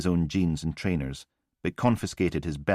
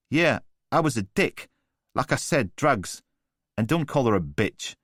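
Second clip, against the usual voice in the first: higher, taunting chav with a sneer; cocky street thug; 6 semitones higher than usual. A narrator's reading. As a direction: needle him like a smug geezer. Voice of Newman the thug.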